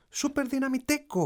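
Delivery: put-on high-pitched little boy's power cry; child's shout. A narrator's reading. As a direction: call it out high like a small superhero fan. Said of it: "φώναξε ο μικρός".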